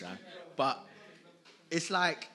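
ordinary talk, nothing marked

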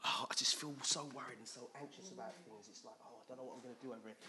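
soft, hurried fretting; mock worry. Preacher voicing an anxious believer, worried murmur. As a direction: fret under your breath.

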